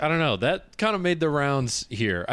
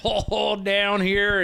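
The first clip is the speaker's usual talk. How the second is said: high, hammed-up Southern twang; mock Southern accent; 9 semitones higher, much slower than usual. American spoofing his own country's accents, exaggerated Southern accent. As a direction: overdo a Southern accent, pitched up. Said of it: "you gotta do Southern".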